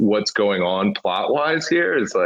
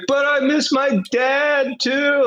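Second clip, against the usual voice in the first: put-on much higher, slow, whimpering child voice; sad little kid; slower than usual. Passenger imitating the kid who misses his dad, high and slow. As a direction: stretch it out like a sad small boy.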